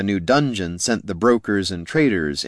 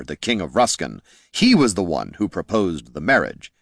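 ordinary delivery

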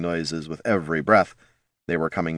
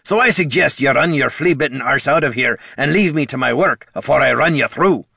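high, louder gruff pirate snarl; snarled threat; 6 semitones higher, 5 dB louder than usual. A narrator's reading. Pirate captain snarling at Bert to get out. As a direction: snarl it at the little kobold to drive him off. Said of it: "snarled Captain Jones".

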